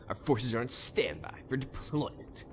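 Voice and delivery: quiet, snide parroting of the line; mock the advisor's smug tone; snide mimicry. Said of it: "You sound so snide".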